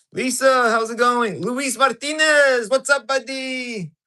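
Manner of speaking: high, loud, slow welcome; excited greetings. Real, not acted. Streamer greeting new viewers in chat, excited. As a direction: call out the names warmly and big.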